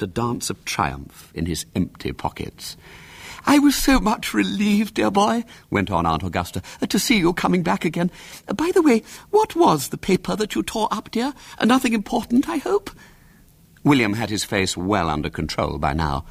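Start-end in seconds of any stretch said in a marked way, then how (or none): 3.5-12.9 put-on high, cooing old lady; fond aunt. A narrator's reading. Fond aunt quizzing William about the paper, high. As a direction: coo then probe gently.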